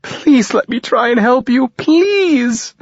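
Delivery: a mother's last tearful plea; begging mother. A narrator's reading. Mother pleads.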